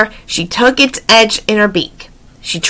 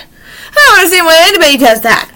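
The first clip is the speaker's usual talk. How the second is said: usual speaking voice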